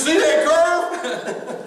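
high and very slow, stretching each word; mock-alarmed passenger, dragging it out; drawn-out warning. Passenger warns about the curve.

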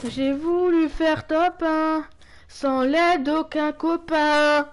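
slow, stretched mock boast; drawn-out slow. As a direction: drag every word out slowly.

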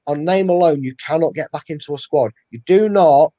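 usual speaking voice

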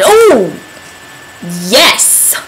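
sudden realization. Real, not acted. Vlogger suddenly remembering her weekend plans, surprised outburst. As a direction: gasp it out as it hits you.

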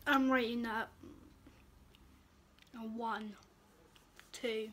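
talking between chews; muffled by food in mouth; mouth full, chewing. Real, not acted. Seen 0: Cheeks bulge, lips pressed, chewing motions between words after tasting.

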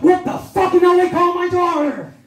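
high, loud, broad West Country outrage; a furious Devon father exploding; angry Devon dad. Retold or imitated. A Devon dad at the wedding yells at his wife, high and broad. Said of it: "he's like".

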